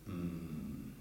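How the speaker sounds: low, soft pastoral hum; calm grandfather. Retold or imitated. Grandfather listening to someone struggling, low calm hum. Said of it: "low baritone voice".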